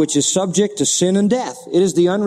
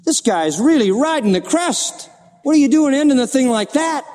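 rising, higher-pitched protest; incredulous objection; 8 semitones higher than usual. Acted. Preacher voices the listener balking at the psalm's ending, incredulous. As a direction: object in disbelief, pitch climbing. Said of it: "You say".

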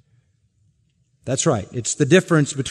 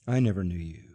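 much lower and softer verdict; quiet verdict; 8 semitones lower, 8 dB quieter, faster than usual. Acted. The Lord turning away the false claimers, hushed. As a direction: let it drop hushed and final.

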